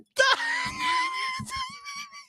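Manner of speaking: very high squealing laugh; real high laughing squeal; shrieking laugh. Real, not acted. Bursts out laughing.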